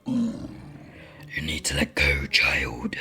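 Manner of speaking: deep raspy demon whisper. Acted. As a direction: hiss it like a lurking demon.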